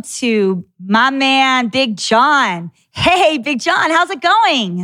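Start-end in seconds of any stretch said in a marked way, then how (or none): 2.9-4.8 high, slowed, drawn-out hey to greet him; excited welcome. Real, not acted. Podcast host welcoming guest Big John, bright drawn-out greeting.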